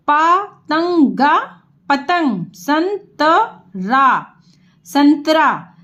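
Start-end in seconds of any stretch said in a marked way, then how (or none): 0.1-1.4 पतंगा drawn out slowly, each part separate; syllable by syllable. Shown as a demonstration. Teacher to pupils, spelling out पतंगा slowly. 2.5-4.3 संतरा stretched out syllable by syllable; slow spelled-out. Shown as a demonstration. Teacher to pupils, sounding out संतरा slowly.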